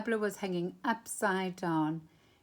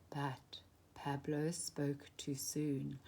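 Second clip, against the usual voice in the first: slow soft whisper of warning; slow down and hint at trouble coming; ominous whisper; 5 semitones lower, 8 dB quieter, slower than usual. A narrator's reading. Ominous turn in the story.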